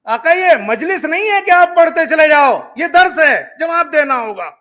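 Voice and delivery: student snapping back in anger; angry protest. Retold or imitated. Student to the ayatollah, angry demand. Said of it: "وہ غصے میں بولا".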